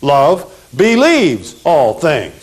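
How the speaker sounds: slow, stretched phrase for repeating; slow recitation. Shown as a demonstration. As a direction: model it slowly for the class. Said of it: "Say it with me".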